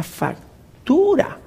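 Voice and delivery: slow, quiet, stunned repeat of factura; stunned echo. Retold or imitated. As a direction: drag out factura in disbelief.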